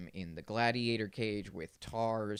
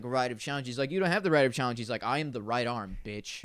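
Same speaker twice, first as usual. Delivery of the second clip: louder, higher hammed-up defiance; mock gladiator challenge; 3 semitones higher, 7 dB louder, faster than usual. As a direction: throw down the challenge hammily.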